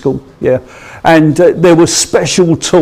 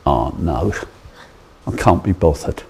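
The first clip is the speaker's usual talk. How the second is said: can't be bothered; 9 semitones lower, 8 dB quieter than usual. Retold or imitated. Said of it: "where I say".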